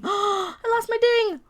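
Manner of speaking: high, slow gasp of fake panic; hammed-up high distress; mock dismay. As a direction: pretend you lost your toy. Mock panic over bell.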